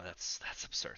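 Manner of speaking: hushed, voiceless aside; whispered. Acted. NPC muttering his denial to the players in a whisper. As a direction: drop to a whisper.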